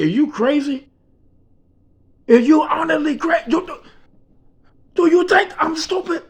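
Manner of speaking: high, indignant disbelief, stumbling over words; incredulous outburst. Retold or imitated. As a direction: act out a boss who feels insulted. Boss reacts in disbelief.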